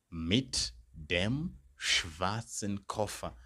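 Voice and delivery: very slow, deliberate repeat of the answer. Shown as a demonstration. Teacher repeating the answer for learners, very slow. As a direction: repeat it slowly for the class. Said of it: "Then I could say".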